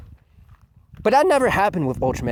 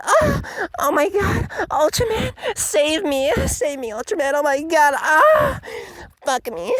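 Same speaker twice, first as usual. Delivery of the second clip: much higher, breathless swooning voice; mock swooning fangirl; 12 semitones higher than usual. Mocking Reina swooning over Ultraman, high breathless voice. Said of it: "And I'm like".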